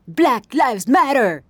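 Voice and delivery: high, loud, drawn-out rallying cry; shouted slogan. Acted. Club owner declaiming a slogan in her tirade, shouting. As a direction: belt it out like a protest slogan.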